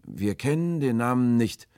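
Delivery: high, loud, plaintive reply; calling answer. A narrator's reading. Stranger answers from the dark, high and loud.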